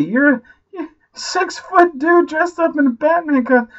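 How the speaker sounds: high, loud disbelieving outburst; exasperated shouting fan at the movie; incredulous rant. Retold or imitated. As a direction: yell at the screen in disbelief. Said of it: "I was just sitting there going".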